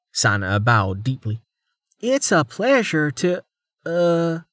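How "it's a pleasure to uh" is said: halting, higher-pitched greeting that stalls; awkward, struggling. A narrator's reading. Officer introduced to Amelia, awkward and struggling. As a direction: start high, then falter into a hesitation. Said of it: "she struggled".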